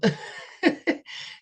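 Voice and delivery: high airy laugh, rising; a rising giggle at her own letdown. Real, not acted. Laughs that nothing happened.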